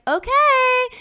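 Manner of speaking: high, loud singsong okay; put-on high-pitched reply; mock agreeable. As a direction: answer in an exaggerated high voice. Retold reply to the kid.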